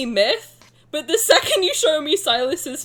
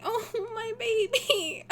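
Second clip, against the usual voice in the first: swooning coo; 7 semitones higher, 6 dB quieter, much slower than usual. Real, not acted. Swooning over character.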